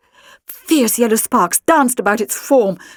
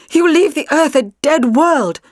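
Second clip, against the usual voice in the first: horrified; 7 semitones higher, 3 dB louder than usual. A narrator's reading. Horrified accusation at Maria.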